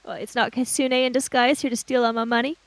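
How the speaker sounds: ordinary delivery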